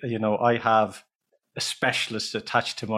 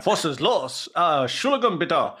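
German phrases in a higher, mock German accent; put-on German accent; 5 semitones higher than usual. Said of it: "I'd put on this".

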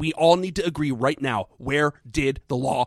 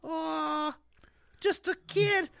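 put-on lonely child Freddy; 13 semitones higher than usual.

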